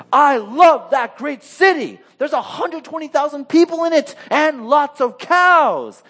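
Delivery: high, loud, drawn-out theatrical quote; exclaimed mock proclamation. Preacher quotes God's closing line to the congregation, hammed-up proclamation.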